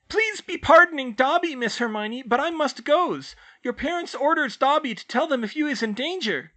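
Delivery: very high, pleading elf squeak; apologetic house elf. A narrator's reading. Dobby apologising, must leave.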